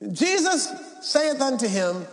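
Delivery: usual speaking voice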